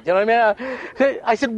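usual speaking voice

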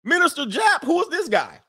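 high, bewildered mock female voice; high mock bafflement; mock confused woman. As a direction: squeak it out in a high puzzled voice. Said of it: "You're gonna be like".

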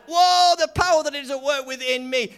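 high, loud astonished cry; be blown away by the power; a believer stunned at the power, loud and high; amazed whoa. Retold or imitated.

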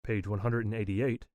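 normal talking voice

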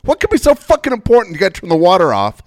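high, loud frustrated ranting; exasperated rant. Retold or imitated. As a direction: son ranting about his mother, loud and exasperated. Speaker's own exasperated rant.

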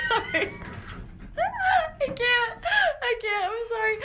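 high giggly apologies; can't get through it laughing. Real, not acted. Cracking up again while reading.

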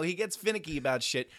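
ordinary delivery

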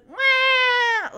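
loud, high mock wail; imitated baby wail. Three-year-old playing baby in the crib, mock wail. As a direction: wail like a fake-crying baby. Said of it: "takes her thumb out of her mouth and goes".

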